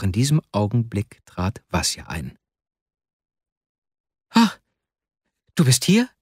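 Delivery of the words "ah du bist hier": high, startled gasp of a question; startled exclamation. A narrator's reading. Wasja finds his friend at his desk, startled. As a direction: cry out as if caught off guard. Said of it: "rief er aus und fuhr vor Schreck zusammen".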